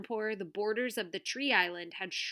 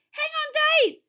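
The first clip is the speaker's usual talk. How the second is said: very high, loud urgent shout; yelled; 11 dB louder than usual. A narrator's reading. Tuna tells dangling man to hold on, urgent yell. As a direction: yell urgent instruction upward. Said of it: "Tuna yelled".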